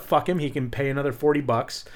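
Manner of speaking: ordinary delivery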